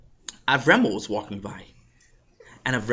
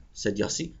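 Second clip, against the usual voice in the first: ordinary delivery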